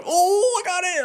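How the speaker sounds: high, laughing cry of victory; gleeful triumph. Real, not acted. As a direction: crow over the stolen toy. Snatching toy from dog.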